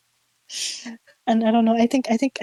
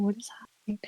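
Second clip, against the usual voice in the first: frightened whisper; 8 dB quieter, faster than usual. Retold or imitated. Freaked out in the haunted tower, whispering in disbelief. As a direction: repeat it in a stunned whisper. Panicking after hearing the ghost.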